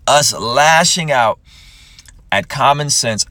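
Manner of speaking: slow, emphatic preaching. Acted. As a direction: hammer the point home like a pulpit. Preaches the verse.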